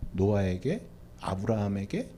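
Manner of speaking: ordinary talk, nothing marked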